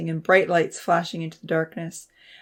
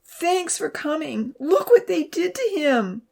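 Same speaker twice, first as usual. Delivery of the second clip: wailing; 11 semitones higher, 3 dB louder than usual. A narrator's reading. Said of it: "Abby wailed".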